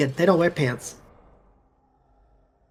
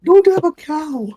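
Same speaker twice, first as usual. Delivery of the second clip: higher, hammed-up Homer Simpson voice; 8 semitones higher, 4 dB louder than usual. Shown as a demonstration. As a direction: do Homer calling for Marge. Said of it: "Homer Simpson".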